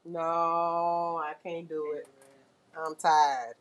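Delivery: low, slow, worn-out machine voice; tired e-file. Pretend. E-file refusing to take off acrylic, low and slow. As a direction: groan it out like a machine that gives up. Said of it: "It's like".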